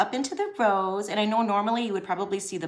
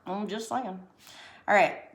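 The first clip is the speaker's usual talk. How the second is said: usual speaking voice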